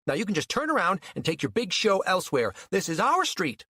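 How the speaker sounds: high, quick scolding; mean, angry order. A narrator's reading. Mean old mother blocking her rival's path, angry order. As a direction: send them packing. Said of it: "The mean old Mary".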